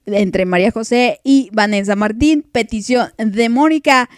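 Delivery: ordinary delivery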